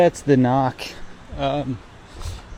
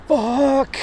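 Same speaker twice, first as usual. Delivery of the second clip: panicked outburst; 12 semitones higher, 4 dB louder than usual. Retold or imitated. Retold panic in the crowd.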